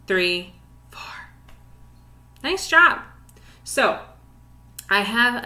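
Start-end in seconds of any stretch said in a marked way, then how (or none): 0.9-1.0 barely voiced, hushed count; whispered count. Shown as a demonstration. Teacher demonstrating silent rest beats to students, whispered. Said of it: "Two and four are silent beats".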